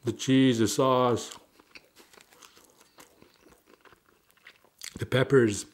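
talking while chewing; muffled through food; mouth full. Real, not acted. Seen 3: Bites sandwich before; cheeks puffed, chewing throughout labelled words.

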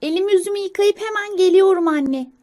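put-on high, loud child squirrel; Çalışkan sincap. Young squirrel answering mother while washing up, high childlike call. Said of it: "Çalışkan sincap".

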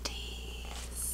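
breathy whispered echo. Real, not acted. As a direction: whisper it like a secret. Echoing the food name softly.

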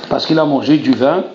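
usual speaking voice

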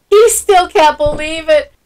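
usual speaking voice

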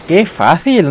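excited. Real, not acted. Boss beaten quickly.

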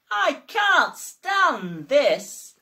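slow, loud, drawn-out grumble; weary complaint. Acted. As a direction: drag every word out, fed up. Mister Bear complains, fed up.

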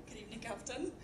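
usual speaking voice

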